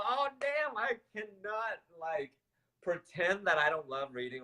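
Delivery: high-pitched, drawn-out mock dismay; acted sudden realization. Woman realizes she loves writing, acted high-pitched outburst. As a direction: let a dawning realization push the voice way up. Acts out her moment of realization.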